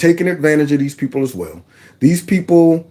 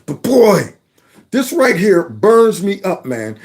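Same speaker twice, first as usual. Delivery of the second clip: ordinary delivery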